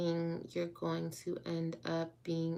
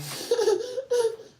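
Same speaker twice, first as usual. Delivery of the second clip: high half laugh half cry; real laughing sob; 6 dB louder than usual. Real, not acted. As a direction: break into a teary laugh. Overwhelmed reaction.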